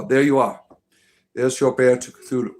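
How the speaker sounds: ordinary talk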